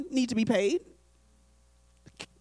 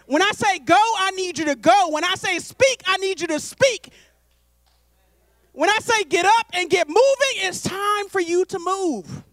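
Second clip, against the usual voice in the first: rising, louder commands from God, building urgency; God commanding; 4 semitones higher, 3 dB louder than usual. Acted. God telling his people to be ready, commanding. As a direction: order them to get up and move. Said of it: "God is like".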